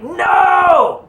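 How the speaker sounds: high, loud, drawn-out shout; visceral scream. Shown as a demonstration. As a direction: scream it from the gut. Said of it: "scream no to and mean it from his core".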